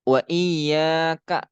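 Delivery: "iyyaka" with a long held YA; dibaca panjang. Shown as a demonstration. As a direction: draw out the middle of "iyyaka", short final ka. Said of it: "Harus dibaca panjang". ("iyyaka" dengan ya dibaca panjang)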